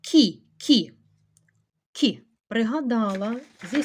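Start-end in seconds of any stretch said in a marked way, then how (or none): 0.0-2.2 short, loud, separate "Кі" repeated; isolated demonstration of "Кі"; half-softened к. Shown as a demonstration. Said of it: "напівпом'якшений".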